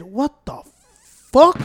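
drawn-out furious what the fuck; slow, loud outraged yell; angry yell. Retold or imitated. Retelling the man who grabbed his phone, slow loud yell.

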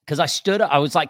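ordinary delivery

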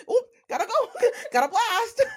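high, laughing mock getaway quip; mock a hasty exit through laughter; giggly high-pitched imitation; Irina impression. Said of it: "And then Irina is like".

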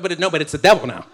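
ordinary talk, nothing marked

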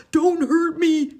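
high, loud, frightened plea; a lion begging not to be hurt; high, loud whimpering plea; scared lion. Acted. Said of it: "he whimpered".

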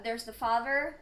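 ordinary talk, nothing marked

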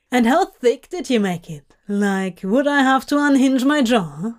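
teasing excitement. Acted. Crowley teasing.

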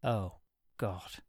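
soft, sinking "oh God"; quiet realization. A narrator's reading. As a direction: let it dawn on him quietly. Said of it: "quietly twigging".

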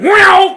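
very high, loud saber burst; imitated lightsaber strike. Anakin striking in an imagined scene, imitated lightsaber noise. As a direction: cut in with a sudden swoosh.

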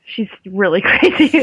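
words breaking into a laugh. Real, not acted. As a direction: say it through rising laughter. Laughs while agreeing she is crazy.

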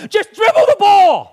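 mock sports-dad shout; frustrated yell. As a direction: holler from the sideline at your kid. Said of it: "we talked a lot about".

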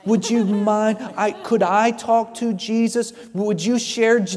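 soft, slow, meek asking; mock timid politeness, barely pushing; a meek voice that does not press, the opposite of the woman's grab; overly polite request. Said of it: "She didn't say".

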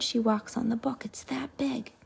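hushed aside; whisper. Real, not acted. Parent whispering an aside to the child.

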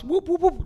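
high-pitched mock siren whoops; imitated police siren. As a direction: whoop it like a squad car.

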